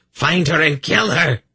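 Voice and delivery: high-pitched murderous shriek; screamed command. A narrator's reading. Kabat sends her fiend to kill, screaming. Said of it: "Kabat screamed after her enslaved fiend".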